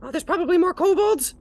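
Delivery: high squeaky, pained then rambling; hurt kobold. Acted. Kobold spilling secrets after the threat, squeaky.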